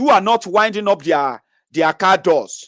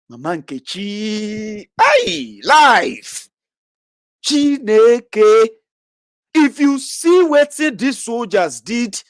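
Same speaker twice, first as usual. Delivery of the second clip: drawn-out, wailing exclamations of shock; dramatic gossiping onlooker; 3 semitones higher, much slower than usual. Acted. Onlooker takes the beating home as a story, dramatic lament.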